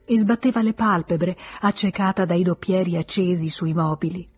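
ordinary delivery

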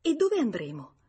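keen, excited urgency; quivering with eagerness. A narrator's reading. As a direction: ask in trembling anticipation. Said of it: "Lei domandò fremente".

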